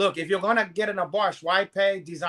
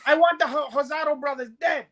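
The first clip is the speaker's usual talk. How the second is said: mock Godfather boss, pitched up; mob boss quote; 8 semitones higher, 4 dB louder than usual. Retelling the Godfather Two boss scene, imitated mobster voice. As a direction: imitate a mobster giving orders. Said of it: "the guy talk like that".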